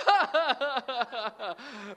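loud, high put-on laughter; imitated copycat laugh. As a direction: fake a big laugh to fit in. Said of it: "I would laugh".

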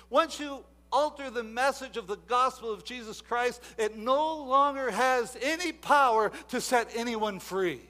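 full-force sermon delivery; fiery preaching. Acted. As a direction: preach it to the back row.

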